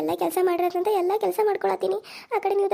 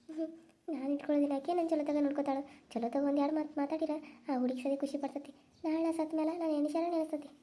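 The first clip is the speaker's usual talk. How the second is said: much softer, hushed private reflection; inner monologue; 12 dB quieter than usual. Acted. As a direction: think it aloud to yourself, barely voiced. Old woman's private inner thoughts.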